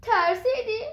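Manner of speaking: loud giant taunt; loud mocking question. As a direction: tease the scared cat with a loud laughing jab. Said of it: "با صدای بلند گفت".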